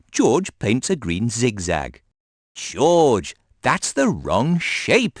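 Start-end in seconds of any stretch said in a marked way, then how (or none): 2.5-5.2 higher, slow correcting; bossy Peppa. A narrator's reading. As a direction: talk down to little brother. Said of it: "says Peppa".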